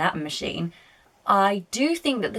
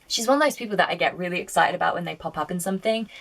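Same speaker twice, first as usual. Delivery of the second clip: rattled off fast in one breath; rushing the words out excitedly; rapid nonstop chatter; faster than usual. Real, not acted. Excited gush about the actress.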